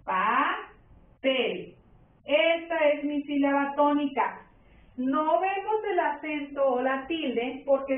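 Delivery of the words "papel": PA... PEL, drawn out with a pause between; "Papel" pulled apart, PA rising, PEL falling; split into syllables. Shown as a demonstration. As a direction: say Papel slowly in two separate beats. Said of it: "Separo en sílabas".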